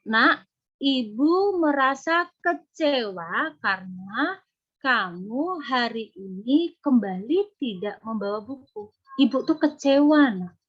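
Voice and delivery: slow, clear disappointed mother; firm and deliberate, never shouting; calm but firm mother's reproach; firm, not loud. Shown as a demonstration. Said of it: "Contohnya tegas adalah".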